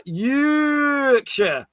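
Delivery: high, long-held first vowel in "Yorkshire"; drawn-out Yorkshire. Acted. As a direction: stretch out "Yorkshire" like a rising call. Reading out a viewer's drawn-out word.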